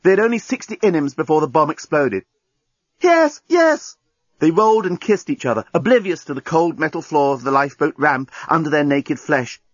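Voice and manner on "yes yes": high cries of passion; ecstatic cries. A narrator's reading. Lovers rolling on the lifeboat ramp, crying out. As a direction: cry out in rapture.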